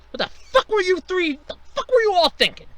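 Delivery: furious yell. Acted. Boss screaming at the network heads, furious. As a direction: rage at the whole table.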